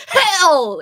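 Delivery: reading an all-caps comment aloud, mock yelling. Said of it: "It's like she was yelling it".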